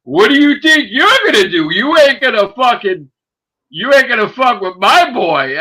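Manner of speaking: loud, high protective shouting; retold angry challenge. Best friend warning off the thief, angry challenge. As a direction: holler him down in your friend's defence.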